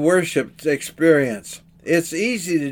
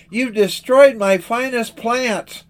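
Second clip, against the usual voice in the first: mildly outraged gardener, higher pitch; upset exclamation; 6 semitones higher than usual. A narrator's reading. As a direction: exclaim it high in storyteller's reproach. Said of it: "he exclaimed".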